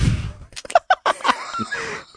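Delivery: a very high shrieking laugh. Real, not acted. Podcaster losing it at the colonic irrigation joke, shrieking laugh.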